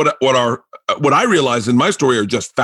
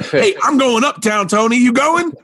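Tracy Morgan calling out, high and pumped; imitating Tracy Morgan; 9 semitones higher than usual. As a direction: pitch it way up, hyped to head uptown. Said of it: "snaps his shirt and he goes".

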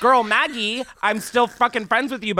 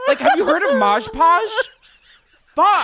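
higher and louder, strained; frustrated near-yell; 12 semitones higher, 7 dB louder than usual. Real, not acted. Host pleading for Mod Podge, higher and louder strained near-yell. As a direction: peak of the rant, pleading. Glitter rant peaking.

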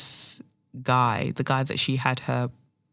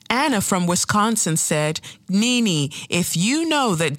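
lightly mimicked show host, higher; mock reading out a made-up viewer question, pitched up; Andy; 5 semitones higher than usual. Reunion host quoting an imaginary viewer to Nene, lightly mimicked. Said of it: "imaginary people that he brings up, yeah, to be like".

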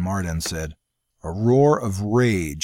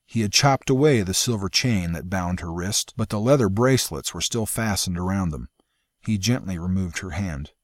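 usual speaking voice

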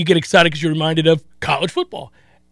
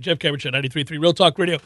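radio station ID; 3 semitones lower, 5 dB quieter, much faster than usual. Acted. Station ID read.

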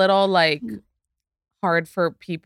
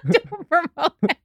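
laughing; 12 semitones higher than usual. Real, not acted. Joking after naming a doctor.

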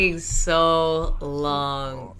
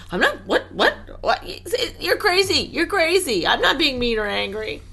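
playful protest; 8 semitones higher than usual. Real, not acted.

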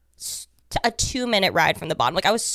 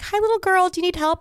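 stranger's voice; 11 semitones higher than usual. Retold or imitated. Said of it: "they'd be like".

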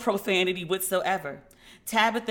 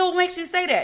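high, indignant challenge; defensive question; 10 semitones higher, 3 dB louder than usual. Retold or imitated. She challenges her dad's verdict, high and defensive. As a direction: push back hard on dad.

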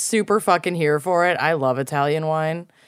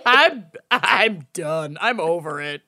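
laughing; 5 semitones higher, slower than usual. Pretend. Mock breakdown, laughing.

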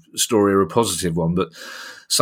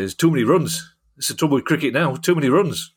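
Jeff Boycott impression; 6 semitones higher than usual. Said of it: "It's basically just kept saying".

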